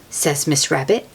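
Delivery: ordinary delivery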